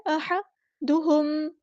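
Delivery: أَحَدُهُمْ with a throaty ح, then a plain ه; slow, contrast ح and ه; careful chant of أَحَدُهُمْ; tajweed recitation. Shown as a demonstration. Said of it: "دھیان سے ادا کرنا ہے".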